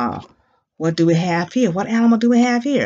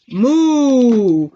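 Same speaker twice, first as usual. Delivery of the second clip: long, loud imitated moo; drawn-out teacher's moo; cow moo; 4 dB louder than usual. Shown as a demonstration. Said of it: "what does a cow say".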